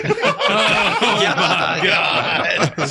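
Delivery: mock shock at the answer, laughing; stunned laughing shout. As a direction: yelp it out while cracking up. Reacting to the cocaine confession.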